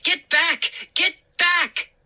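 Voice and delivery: high, loud repeated get back; frantic shout. A narrator's reading. Narrator yells at the crowding gnomes, high frantic shout. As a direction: shove them off with your voice.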